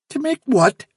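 high-pitched baffled question; incredulous fox. A narrator's reading. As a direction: ask it in disbelief.

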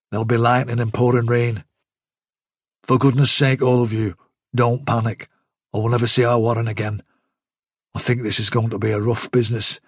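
understated, sober warning to the group; sober rabbit soldier. Acted. Silver warning the rabbits not to panic, lightly voiced and sober.

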